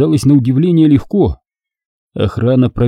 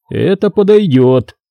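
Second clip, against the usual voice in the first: higher, slow, mechanically compliant echo; obedient repetition; 7 semitones higher, slower than usual. A narrator's reading. Guard repeating the Jedi's suggestion, obedient. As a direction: echo "подойдёт" slowly, pitched up, like one under suggestion. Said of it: "послушно повторил охранник".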